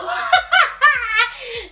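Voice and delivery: a high burst of laughter; amused laugh at the memory. Real, not acted.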